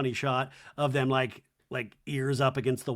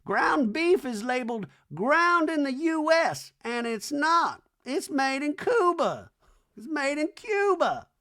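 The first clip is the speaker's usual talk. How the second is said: high, slow hammed-up Reba; lofty slow mock-mom rant; 12 semitones higher, 4 dB louder, slower than usual. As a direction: drone it like a grand old dame. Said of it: "Reba's like".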